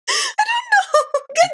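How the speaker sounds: high, slow laughing words. Real, not acted. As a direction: talk through real laughter. Laughing after teasing joke.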